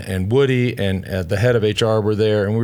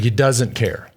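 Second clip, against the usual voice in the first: ordinary delivery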